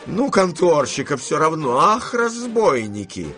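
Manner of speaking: high-pitched outburst, rising into a drawn cry; indignant. Acted. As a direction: exclaim in mounting outrage. Grandmother outraged at the rate. (возмущённо, высоко, нараспев)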